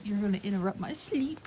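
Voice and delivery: soft, whispered sleepy-horse complaint; horse's voice. Retold or imitated. Vlogger voices the horse whose sleep is interrupted, whispering. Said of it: "Or she goes".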